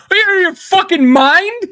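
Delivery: high-pitched disbelieving cry; shocked outburst. Retold or imitated. Telling a friend's story, a high shocked cry. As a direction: blurt it out in sheer disbelief.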